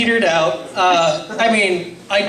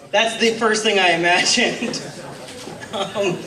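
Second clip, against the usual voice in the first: normal talking voice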